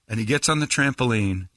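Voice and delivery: ordinary talk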